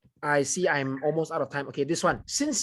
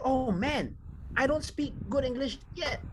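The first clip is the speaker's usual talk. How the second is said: higher, softer voice of a fretting learner; mock worried learner, higher and quieter; worried student; 7 semitones higher, 5 dB quieter than usual. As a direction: play a discouraged student, up and soft. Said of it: "If you feel that".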